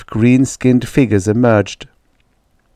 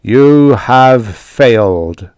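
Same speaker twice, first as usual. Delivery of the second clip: loud, very slow verdict; booming, drawn-out alien pronouncement; roaring voice; 6 dB louder, much slower than usual. A narrator's reading. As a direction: roar each word as a judgement. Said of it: "roared the voice".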